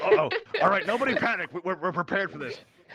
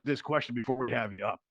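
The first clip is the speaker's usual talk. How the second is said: usual speaking voice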